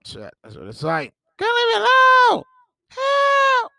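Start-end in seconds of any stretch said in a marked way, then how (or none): 1.4-3.7 high squeaky mock cry for help; hammed-up falsetto distress; mock falsetto plea. Mock distress over scandals.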